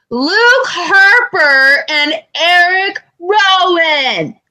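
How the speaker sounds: high, drawn-out name call; retold indignant holler. Fan retelling answer to clueless crowd, drawn-out holler.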